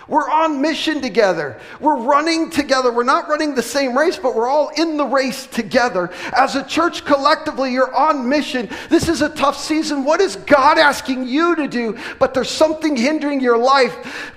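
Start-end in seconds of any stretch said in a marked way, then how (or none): 0.1-12.0 higher and louder, rallying the church; preaching at full force. Acted. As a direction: drive it home to the congregation. Preaching climax.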